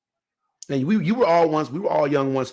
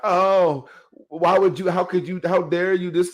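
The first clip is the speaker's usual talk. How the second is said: higher and louder, hammed-up hurt pleading; mock wounded; 4 semitones higher, 3 dB louder than usual. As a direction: play the injured victim, overdone. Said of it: "went all Wounded Knee on him".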